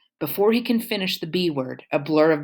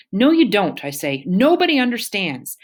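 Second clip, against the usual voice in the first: loud, higher, hurt outburst; angry outburst; 6 semitones higher, 4 dB louder than usual. Acted. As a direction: snap back at Mama in anguish. Starr erupts at Mama.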